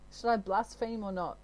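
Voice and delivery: usual speaking voice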